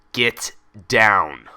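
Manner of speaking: loud, barked order; harsh command. Acted. Orderly ordering Twilight off the bench, loud and harsh. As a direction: snap it out with authority. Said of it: "The harsh command".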